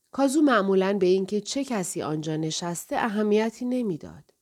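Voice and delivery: ordinary delivery, nothing marked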